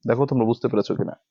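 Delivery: normal talking voice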